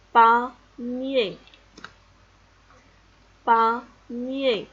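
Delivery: "panier" split loudly into pa, nié; mot découpé. Shown as a demonstration. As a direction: sound out "panier" syllable by syllable. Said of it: "Comme dans le mot". (« panier » découpé fort en pa, nié)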